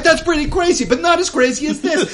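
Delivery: loud, high, excited TV pitchman; home shopping host. Acted. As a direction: sell it like an infomercial host. Camera swings, galley cook pitches fries.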